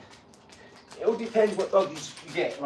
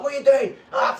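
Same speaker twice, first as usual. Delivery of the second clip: mock yell; 10 semitones higher, 8 dB louder than usual. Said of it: "He's like".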